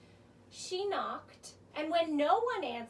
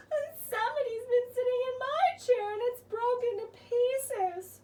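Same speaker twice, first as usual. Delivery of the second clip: much higher, fast tearful cub voice; crying baby bear; 8 semitones higher, 4 dB louder than usual. Acted. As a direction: wail it like an upset little cub. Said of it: "cried the baby bear".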